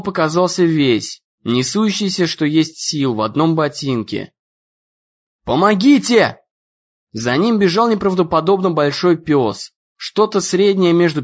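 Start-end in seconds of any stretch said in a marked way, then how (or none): 5.4-6.4 a raised, higher call for help; cry for help. A narrator's reading. Ian fleeing the huge dog, calling louder for help. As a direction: shout for help while running. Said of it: "крик о помощи".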